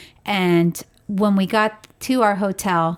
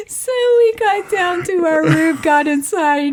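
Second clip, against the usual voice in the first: laughing; 8 semitones higher, 3 dB louder than usual. Real, not acted. Laughing at the story.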